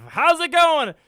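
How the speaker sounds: high, loud upbeat hello; excited greeting. Real, not acted. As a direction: greet the crowd with big energy. Greeting stream audience excitedly.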